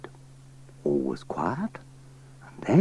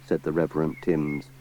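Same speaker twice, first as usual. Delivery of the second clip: usual speaking voice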